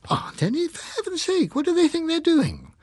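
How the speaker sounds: aghast at the news; indignant disbelief. A narrator's reading. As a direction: shoot up in pitch, scandalised. She stared at me, indignant disbelief.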